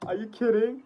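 usual speaking voice

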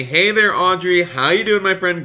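upbeat, high-pitched streamer greeting; excited greeting. Real, not acted. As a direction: call out an excited hello to a friend. Streamer greets a returning viewer.

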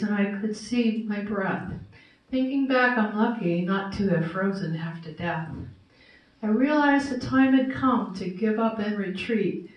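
measured, rhyming recitation; steady storyteller reciting verse; poem reading. Acted. Reads a rhyming poem aloud.

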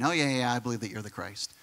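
low, trailing-off mock assent; lightly mimicked, dismissive yes; glib agreement. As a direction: toss it off as an offhand imitation. Voicing Martha's glib agreement.

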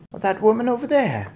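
put-on high-pitched old lady voice; sly grandmother. A narrator's reading. Grandmother points out witch to father, sly. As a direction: point her out with relish.

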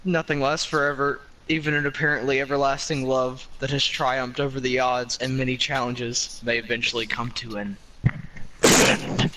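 low, fading epilogue voice; dramatic narration. A narrator's reading. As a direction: read it like a tragic epilogue.

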